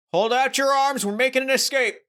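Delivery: hammed-up high yell; mock scream. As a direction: cry out high, as if mid-escape. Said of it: "and I just scream".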